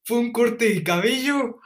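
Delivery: student pushing back at the sergeant, indignant; exasperated outburst. Retold or imitated. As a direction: blurt it out, fed up. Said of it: "Y yo así como de".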